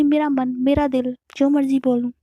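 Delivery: defiant retort. Acted. Aarti defying Navya, loud stubborn retort. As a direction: dig in your heels.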